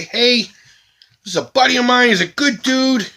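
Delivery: high, slow, drawn-out endorsement; talking up a friend. Shown as a demonstration. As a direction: sell your buddy with big, stretched words. Demonstrates how to pitch the link.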